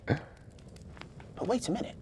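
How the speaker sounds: put-on high, soft, slow child voice; a hesitant, quiet objection; little girl. A narrator's reading. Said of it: "said Victoria".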